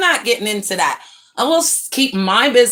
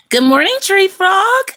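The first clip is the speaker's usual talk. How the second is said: high, loud sing-song hello; greeting a viewer on the live stream, high and cheerful; bright greeting; 12 semitones higher, 5 dB louder than usual. Real, not acted. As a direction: call out a cheery good morning.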